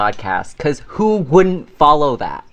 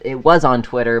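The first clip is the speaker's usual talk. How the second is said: usual speaking voice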